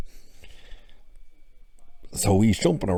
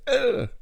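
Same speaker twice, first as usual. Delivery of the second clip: sudden high-pitched ugh of pain; pained grunt. Retold or imitated. Theo hit by a falling book, high pained grunt. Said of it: "Theo kinda goes".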